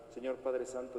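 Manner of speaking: normal talking voice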